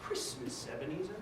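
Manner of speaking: soft, low posh British line; posh accent. Shown as a demonstration. Director demonstrates the line for a volunteer, posh accent. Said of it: "in your best posh accent".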